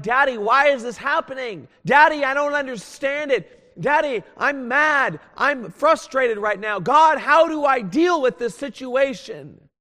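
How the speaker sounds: put-on high, loud, childlike pleading to God; a grown man voicing an upset child complaining to Daddy. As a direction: cry out like a frustrated little kid to his father. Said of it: "just as a child be like".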